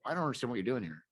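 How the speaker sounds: lightly voiced mocking quote; mock character line. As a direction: toss off a character's line in a put-on voice.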